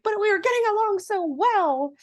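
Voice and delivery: high, put-on whine of protest; whiny Calliope. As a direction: whine it high like a dragged-off stepsister. Calliope dragged away whining.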